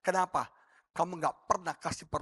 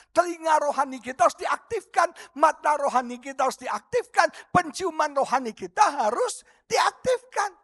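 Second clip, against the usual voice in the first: raised, chant-like repeated exhortation; emphatic preaching; 9 semitones higher, 6 dB louder than usual. Real, not acted. Preacher urging that spiritual senses be activated, emphatic preaching. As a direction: hammer each repeated phrase to the congregation.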